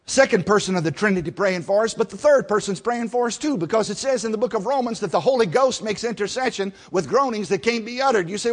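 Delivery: usual speaking voice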